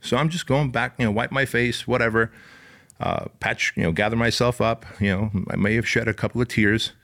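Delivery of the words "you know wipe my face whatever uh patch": ordinary talk, nothing marked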